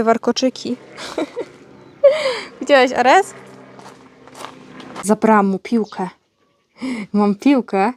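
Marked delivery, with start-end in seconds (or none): none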